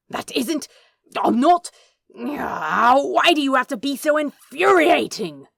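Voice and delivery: loud, sputtering frustration; splutter, too angry to finish; exasperated. Acted.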